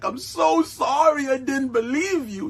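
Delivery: lightly voiced tearful wife; mimicking Beck. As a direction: play her meek sorry. Beck apologizing, voiced quote.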